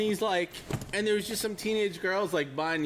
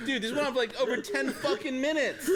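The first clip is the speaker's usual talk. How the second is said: usual speaking voice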